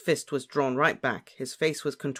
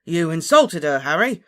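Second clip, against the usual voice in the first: loud, high, indignant yell; shouting; 5 semitones higher, 11 dB louder than usual. A narrator's reading. Ron accuses Harry of insulting beloved, shouting. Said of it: "shouted Ron".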